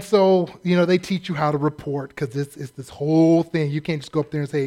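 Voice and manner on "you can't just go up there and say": ordinary delivery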